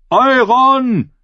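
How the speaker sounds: high, drawn acclaiming shout; calling out. A narrator's reading. Lucas Quad acclaims Euron, high shout. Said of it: "Rief Linkshand Lucas Quad".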